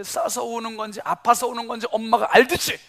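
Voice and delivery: usual speaking voice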